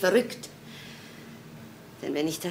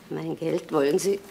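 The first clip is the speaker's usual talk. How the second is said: ordinary talk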